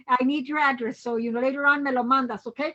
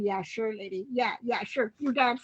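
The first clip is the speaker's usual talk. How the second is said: low, softer, lightly mocked skepticism; retold dismissive disbelief; 4 semitones lower, 6 dB quieter than usual. Booksellers brushing off an excited dancer, mildly dismissive. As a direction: humor her without believing a word. Sellers humoring her, not believing.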